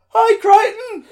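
put-on cheery kid greeting. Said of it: "he goes".

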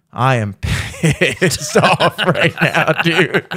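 slow, broken up by laughter; mock tearful anger. Retelling being mad and sad, mock-crying. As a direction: drag it out through laughs. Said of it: "I was so fucking mad and".

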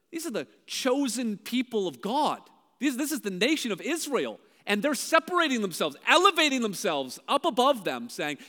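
ordinary talk, nothing marked

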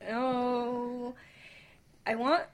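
normal talking voice

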